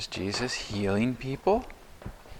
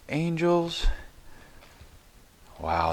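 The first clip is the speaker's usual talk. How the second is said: ordinary talk, nothing marked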